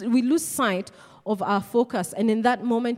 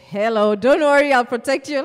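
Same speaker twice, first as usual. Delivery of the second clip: louder, sure-of-himself promise; confident protector; 3 semitones higher, 8 dB louder than usual. Retold or imitated. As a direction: say it like the strong guy everyone trusts. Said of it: "But when this guy says".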